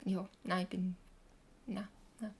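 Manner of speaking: ordinary talk, nothing marked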